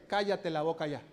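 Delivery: low, soft mock hush at a neighbour; mock shushing. Shown as a demonstration. Preacher shows the church how to hush a neighbour, low mock hush. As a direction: hush your neighbour jokingly. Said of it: "Hazle a la persona que tienes a un lado".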